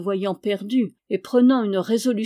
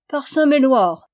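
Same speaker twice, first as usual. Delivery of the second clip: loud, high exclamation; delighted outcry; exclaimed oath; 5 semitones higher, 7 dB louder than usual. A narrator's reading. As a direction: burst out with a ringing oath. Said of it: "s'écria le chevalier".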